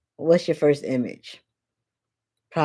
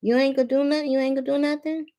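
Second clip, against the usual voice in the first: higher mocking girlfriend taunt; goading taunt; 7 semitones higher, faster than usual. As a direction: egg him on with a needling tone. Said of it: "her putting that two cent in".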